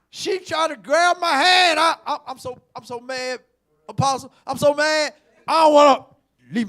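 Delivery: loud, high, heated preacher's cry; shouting the story to the congregation; full-force preaching. Acted.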